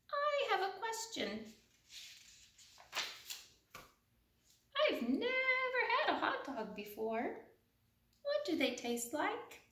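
high, soft, wide-eyed voice; innocent duckling. Acted. The duckling asking the pigeon softly what hot dogs taste like. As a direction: ask it sweetly like a tiny chick.

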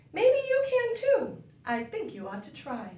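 plain reading voice

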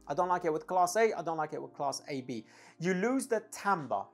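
usual speaking voice

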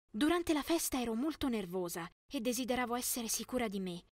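usual speaking voice

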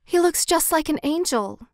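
a hint of children in admiration; admiring children. A narrator's reading. Charity children admire the statue.

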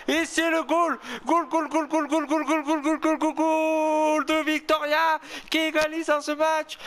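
excited goal call. Acted. Streamer calling the opponent's equaliser in commentator style. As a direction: hammer out goal after goal like a TV commentator.

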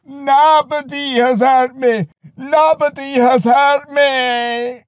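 loud, very high, drawn-out giant's cry; howling wail. A narrator's reading. Blinded Polyphemus cries to his neighbours, howling wail. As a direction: wail it slowly at full pitch. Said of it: "another howling wail and cried".

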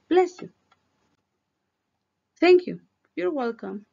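high, loud sing-song courtesy phrases; magic words. Shown as a demonstration. Teacher acting out the sneeze exchange, high and cheerful. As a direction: chirp them out brightly for kids. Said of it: "Esas son nuestras magic words".

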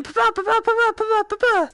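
high rapid "pa-pa" shots; playful high-pitched gun noises; imitated gunfire. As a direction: play at firing a gun with your mouth.

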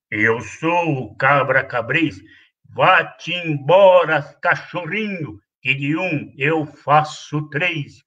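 low, slow, gruff goat threat; menacing billy goat. Acted. Bully goat chasing the kid away, slow low threat. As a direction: loom over him, slow and low.

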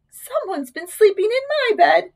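high-pitched mother bear; middle-sized Mama Bear voice. A narrator's reading. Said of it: "said in her middle-sized voice".

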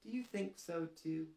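plain reading voice

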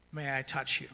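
soft, low, careful question; gently asking permission. Acted. Camp leader to a child who's been through trauma, gently asking permission. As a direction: ask quietly and gently for consent. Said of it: "we're asking permission".

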